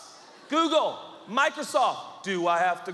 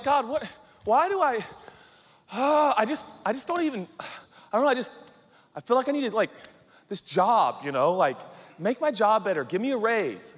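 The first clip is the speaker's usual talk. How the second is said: higher, halting gripe; frustrated complaining; 5 semitones higher, 4 dB louder than usual. Acted. Worker asks God for a better job, frustrated whine. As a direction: stammer out a mock complaint.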